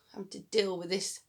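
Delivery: ordinary delivery, nothing marked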